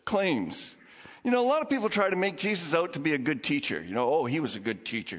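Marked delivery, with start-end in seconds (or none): 4.1-5.2 dismissive mimicry. Mimicking people who dismiss Jesus.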